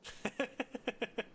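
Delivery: high staccato giggle; helpless high-pitched cackle; laugh. Real, not acted. Streamer amused by viewers, high rattling laugh.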